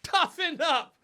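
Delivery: high, loud echo through laughter; laughing. Real, not acted. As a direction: crack up repeating the advice. Laughingly echoes co-host's advice.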